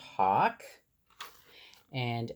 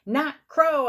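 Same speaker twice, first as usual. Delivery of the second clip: higher and louder, calling out; excited call; 10 semitones higher, 6 dB louder than usual. A narrator's reading. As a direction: call it across the meadow. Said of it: "I called".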